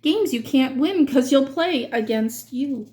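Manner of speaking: plain reading voice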